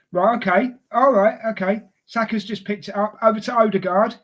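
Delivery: ordinary talk, nothing marked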